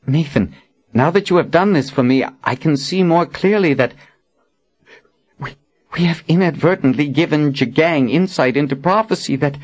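high, halting realisation; worried Warren, earnest. A narrator's reading. Warren warns Nathan they have helped Jagang, earnest alarm. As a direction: work it out aloud, faltering.

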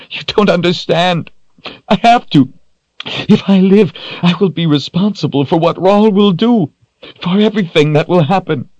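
very high, anguished outburst; crying plea. A narrator's reading. Kahlan explains why she must die, crying in anguish. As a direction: wail the words, pitched high and pushed. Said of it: "she cried".

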